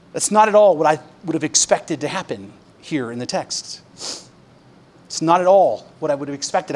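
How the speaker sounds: ordinary talk, nothing marked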